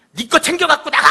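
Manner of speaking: high, loud screech of an acted angry wife; shrieked order to get out; furious yell. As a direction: scream it at your husband in mock fury. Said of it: "소리를 빽 질렀습니다".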